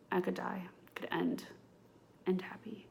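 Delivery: unvoiced whisper, trailing off in longing; dreamy whisper. Real, not acted. Podcaster dreaming aloud of Barefoot Dreams sponsoring her, whispered. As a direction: whisper it like a wish you barely dare say.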